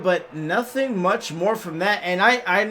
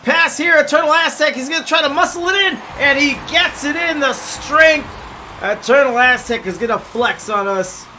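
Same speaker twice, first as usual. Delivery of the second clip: fast, rising sports commentary; live play-by-play; 4 semitones higher, 5 dB louder than usual. Acted. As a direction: call the game as it happens.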